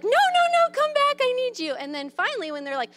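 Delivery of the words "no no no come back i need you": high, louder hammed-up wail; mock desperate begging. Mom pretends to need her child, high mock wail. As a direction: plead like you can't bear them leaving.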